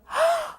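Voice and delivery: put-on high delighted child's gasp; sharp high gasp of delight; excited gasp. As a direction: gasp with joy like a small child. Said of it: "come out of the kitchen and go".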